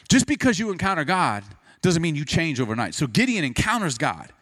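ordinary talk

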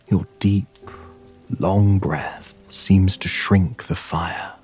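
plain reading voice

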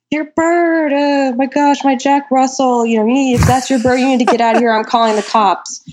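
high-pitched imitation of an upset neighbor; mock screaming. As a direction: mimic someone hollering in outrage. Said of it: "like, screaming".

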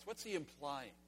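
ordinary talk, nothing marked

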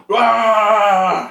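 long, high, loud mock roar; mock yell. Officer reacts to "I'm a cop", loud mock yelling. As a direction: imitate an officer exploding in one drawn-out yell. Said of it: "They just like to yell".

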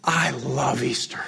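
slow breathy whisper. Retold or imitated. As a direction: whisper it like a secret joy. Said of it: "he says".

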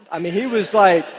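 ordinary talk

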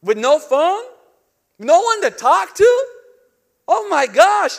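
loud, high mock dismay; mock horror. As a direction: ham up the horror of no phone.

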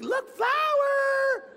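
put-on very high, loud, stretched-out child squeal; distracted little girl. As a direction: imitate a girl delighted by a find. Voicing distracted girl during the game.

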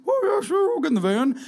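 put-on a gullible kid jumping at candy; naive kid. Preacher plays an eager child saying yes to the devil, high and bright.

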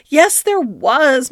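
drawn-out plaintive protest; insistent. A narrator's reading. Duchess insisting she swallowed the pan, protesting.

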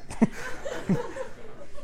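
wordless, breathy laughter; amused laugh. Real, not acted. Preacher tickled by a rant that isn't one, soft laugh. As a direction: laugh softly at the absurdity.

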